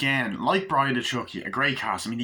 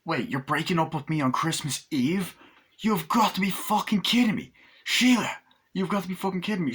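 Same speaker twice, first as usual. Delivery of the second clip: hammed-up fury at the breakup; mock outrage; 6 semitones higher than usual. Acting out angry character.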